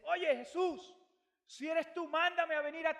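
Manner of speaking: high, loud, eager call. Retold or imitated. Peter calls to Jesus from the boat, high and eager. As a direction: shout across the water, pleading to come.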